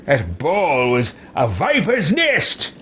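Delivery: slow, theatrical character voice, swooping on "viper's nest"; hammy, drawn-out dramatic delivery; in-character line reading. Acted. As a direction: declaim it grandly, drawing out each word. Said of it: "let's go with this".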